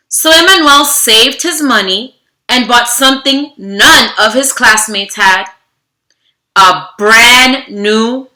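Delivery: steady storybook narration, slowing on key moments; reading to children. Acted. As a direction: read the story aloud to a class. Reads picture book to children.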